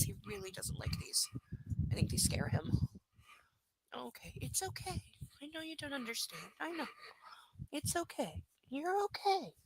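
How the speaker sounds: soft, high reassuring pet talk; calm a frightened dog gently; soothing the puppy. Real, not acted.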